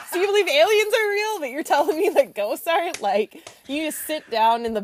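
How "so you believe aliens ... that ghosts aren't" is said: exasperated laughing. Retold or imitated. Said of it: "I'm like".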